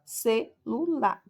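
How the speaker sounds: "celular" slowly broken into ce, lu, lar; one voice pulse per syllable of "celular"; sílaba por sílaba. Shown as a demonstration. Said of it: "três emissões de voz, ó". ("celular" pausado, sílaba por sílaba)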